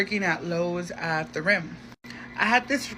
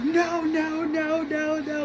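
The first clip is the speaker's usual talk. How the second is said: freaking out; 10 semitones higher, much slower than usual. Retold or imitated. Friend on FaceTime can't believe it, panicked denial. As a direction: pile up the noes in alarm. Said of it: "she was freaking out".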